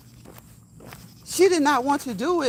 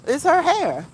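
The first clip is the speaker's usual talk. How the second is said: laughing; 6 semitones higher, 4 dB louder, slower than usual. Real, not acted. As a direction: repeat it with a laugh, higher and louder.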